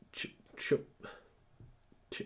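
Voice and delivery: normal talking voice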